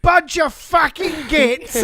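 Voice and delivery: high, loud, slow mock Cockney; Cockney insult. Co-host mocking British accent, loud Cockney. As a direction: spit it out as a cartoon Cockney. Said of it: "in an English accent".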